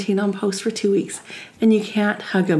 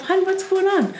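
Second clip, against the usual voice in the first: gentle self-talk; 8 semitones higher than usual. Shown as a demonstration. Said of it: "Ask yourself".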